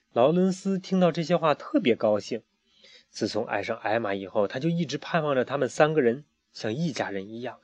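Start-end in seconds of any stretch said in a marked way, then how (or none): none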